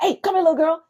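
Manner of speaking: high-pitched imitation of a man calling out; mock yelling. Man running at her down the street, mock yelling. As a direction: mimic a yell without full volume. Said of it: "This man just yelling".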